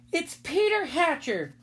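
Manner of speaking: high, slow accusing voice; drawn-out, high accusation; indignant tattling girl. A narrator's reading. Sheila tattles to Henry.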